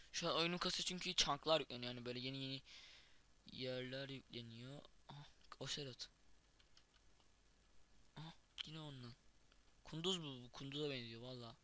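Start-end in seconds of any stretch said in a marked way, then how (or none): none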